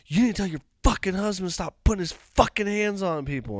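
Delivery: high-pitched imitation of an irate woman; acted angry demand. Woman telling the wife off, imitated angry demand.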